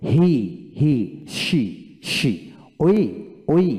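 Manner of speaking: "হি", "শি", "ওই" with lengthened ee endings; long ee. Shown as a demonstration. Teacher drills "হি", "শি", "ওই" with a long ee. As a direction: hold the final ee in "হি", "শি", "ওই". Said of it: "দীর্ঘ ইকার হবে".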